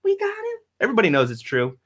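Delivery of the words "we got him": much higher, rising mock-reassuring drunk guy; beer-holding party guy, pitched way up, climbing higher; Busch Light guy. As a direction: ham up the beer guy promising to watch the baby. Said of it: "a guy with a Busch Light".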